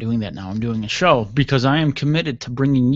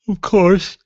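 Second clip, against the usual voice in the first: sarcastic; 8 semitones higher, 8 dB louder than usual. Real, not acted. Ironic complaint about late email.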